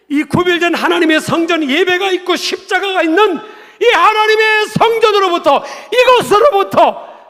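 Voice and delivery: high, loud sermon proclamation; forceful preaching. Real, not acted. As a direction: drive each phrase home at full force. (힘주어 외치는 설교)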